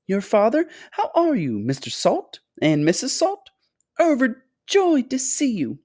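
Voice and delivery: high, gushing, effusive host; excitable fluty factory owner. A narrator's reading. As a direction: greet them in a high, fluty, delighted voice. Story figure: fluty factory owner greeting.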